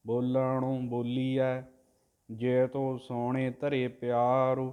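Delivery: drawn-out devotional chanting, word by word; slow santhiya recitation of scripture; slow Gurbani recitation. Shown as a demonstration. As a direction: chant each syllable slowly and evenly, like a granthi. Teacher chants the Gurbani line.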